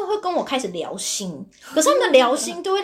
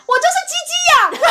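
put-on high, slow, loud blurted yell of a little boy; a small boy bursting out, hammed-up; kid's sudden shout; 6 dB louder, slower than usual. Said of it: "突然哦放下书包大叫说". (小男孩放下书包，尖声大叫)

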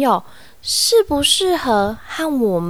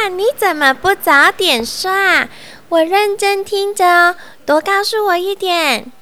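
excited bug voice; 8 semitones higher than usual. Acted. Bug eager.

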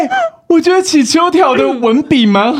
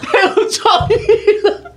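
laughing; 9 semitones higher, slower than usual. Real, not acted. Laughing at the comment.

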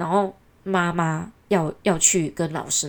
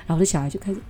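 ordinary talk, nothing marked

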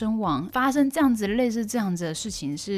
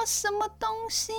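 startled; 4 dB quieter than usual. Retold or imitated. Retold shock at news.

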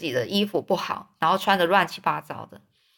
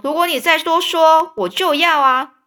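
put-on higher, louder threatening kid; threat; 7 semitones higher, 5 dB louder than usual. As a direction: warn him off hotly. Tom threatens rival.

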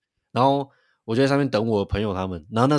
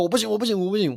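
pitched way up, a half-acted panic cry; mock panicked pleading; 10 semitones higher, faster than usual. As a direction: replay the panic underwater, pleading high. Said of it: "一直发出我不行的那种声音".

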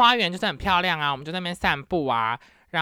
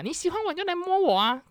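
much higher, sing-song imitation; mocking his line; 13 semitones higher than usual. Vlogger re-quotes Greek date mockingly, much higher. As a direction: parrot his creepy demand. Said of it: "超怪的欸".

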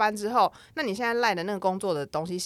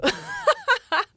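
high laugh; 4 dB louder than usual. Real, not acted. Laughing at own slacking trick.

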